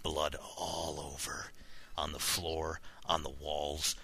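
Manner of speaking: low, soft suspenseful reading; horror story narration. A narrator's reading. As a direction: read it hushed and low, pausing between short lines. Reads horror story aloud.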